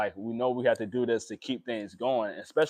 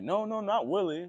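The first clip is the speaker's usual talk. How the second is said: higher, lightly voiced friend; friend correcting; 9 semitones higher than usual. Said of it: "he was like".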